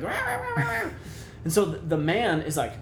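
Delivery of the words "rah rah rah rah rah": very high-pitched, squealed mock barks; imitated dog barking. Storyteller playing the dog yapping at other dogs, imitated barking. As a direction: yap wildly like an agitated dog. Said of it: "the dog is like".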